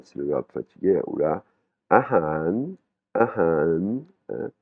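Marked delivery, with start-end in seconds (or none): 1.9-4.0 "ahan" dipping, then rising at the end; blue contour tone. Shown as a demonstration. Teacher models the blue word, dipping-rising "ahan". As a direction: bend "han" down and swing it up. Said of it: "on a du bleu".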